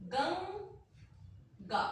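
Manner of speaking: गंगा drawn out slowly, nasal ं held in the throat; slow demo of गंगा. Shown as a demonstration. Teacher slowly models गंगा for her students. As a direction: stretch गंगा with the nasal kept inside. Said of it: "देखिए".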